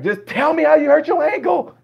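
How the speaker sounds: high, loud mock-desperate plea; exasperated outburst. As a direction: burst out as if out of patience. Fed-up listener interrupts the ramble.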